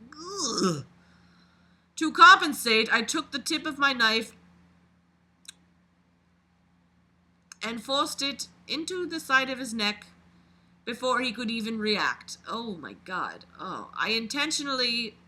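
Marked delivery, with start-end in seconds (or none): 2.0-12.4 reading a dark first-person story aloud, a bit louder; dramatic read-aloud of a creepy story. A narrator's reading. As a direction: narrate it like a confession being read out. Reads the killer's story aloud.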